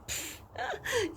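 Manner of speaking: high, breathy unvoiced laugh; a sudden airy burst of laughter. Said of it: "He laughed".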